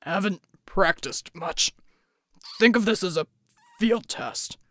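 deep voice, forced and halting; strained rumble. A narrator's reading. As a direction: push it out haltingly under strain. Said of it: "usual rumble now a bit forced and stuttering".

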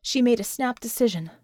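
usual speaking voice

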